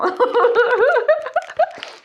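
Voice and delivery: high, loud burst of laughter; real giggling fit, pitched high; laughing. Real, not acted. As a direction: laugh out loud at your own joke. Laughs at own joke.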